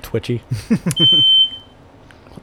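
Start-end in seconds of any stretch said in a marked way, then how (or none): 0.5-1.6 startled squeal. Real, not acted. Startled by smoke alarm beep.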